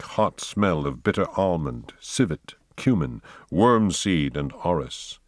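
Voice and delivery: ordinary delivery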